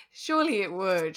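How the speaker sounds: chuckling as you agree; amused, laughing agreement. Real, not acted. Joking about the unicorn cake.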